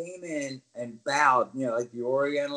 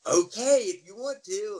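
higher, loud okay easing off; lightly voiced, raised-pitch concession; conceding agreement; 6 semitones higher, 5 dB louder than usual. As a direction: imitate a colleague giving in. Luna agreeing reluctantly, acted out.

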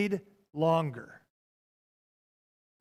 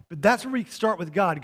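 usual speaking voice

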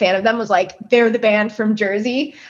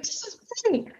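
much higher and much softer, almost breathed; imitating hushed praise; 12 semitones higher, 12 dB quieter than usual. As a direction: say it softly in awe. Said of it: "He's like".

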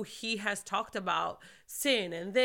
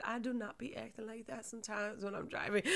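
laughing at herself; 9 dB quieter than usual. Real, not acted.

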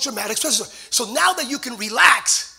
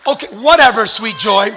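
laughing dismissal; 11 dB louder than usual. Retold or imitated. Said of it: "Everyone around her literally said".

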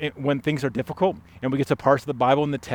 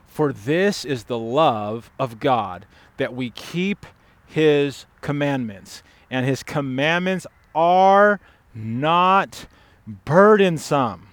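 solemn scripture reading swelling louder; 4 dB louder, slower than usual. Acted. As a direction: read the verse slowly, building to the end. Reads scripture verse aloud.

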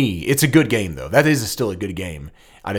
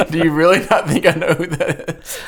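incredulous; 9 semitones higher, 5 dB louder than usual. Retold or imitated. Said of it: "I was like".

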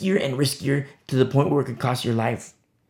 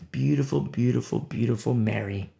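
tender praise; 4 semitones lower, 5 dB quieter than usual. Real, not acted.

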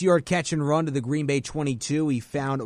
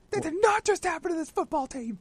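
mimicking shocked call; 14 semitones higher, 3 dB quieter than usual. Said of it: "should have said Mitch after".

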